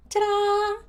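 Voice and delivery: a chirpy little creature showing off; singsong Hestu voice. A narrator's reading. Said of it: "Hetsu sang".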